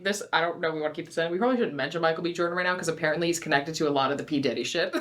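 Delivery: fast hushed aside. Real, not acted. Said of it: "On a real note".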